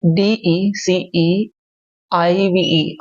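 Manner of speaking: letters spelled out very slowly; dictating letters one by one; slow spelling out. Shown as a demonstration. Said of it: "पर इसको देख के तुम ये सही मत कराना".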